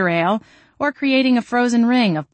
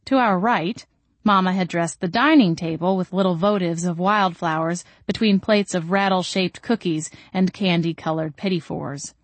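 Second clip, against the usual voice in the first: plain reading voice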